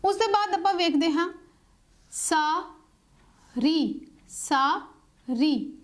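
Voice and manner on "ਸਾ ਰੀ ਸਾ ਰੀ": ਸਾ and ਰੀ held long, with a pause after each; syllable by syllable. Shown as a demonstration. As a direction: slowly sound out ਸਾ, then ਰੀ. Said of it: "ਹੁਣ ਕਿੰਨੇ ਹੋ ਗਏ".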